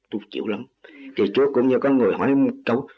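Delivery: normal talking voice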